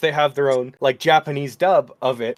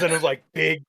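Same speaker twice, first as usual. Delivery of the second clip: normal talking voice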